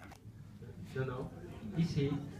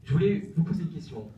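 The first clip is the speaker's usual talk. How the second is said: to someone hard of hearing; 4 semitones higher, 10 dB louder than usual. Real, not acted. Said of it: "je vous entends".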